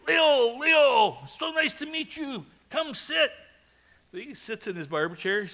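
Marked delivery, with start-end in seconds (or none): 0.1-3.3 high, loud, animated greeting; very excited. Retold or imitated. Barber welcoming Leo to his chair, very excited. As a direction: imitate a crazy, excitable barber calling out. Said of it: "very excited".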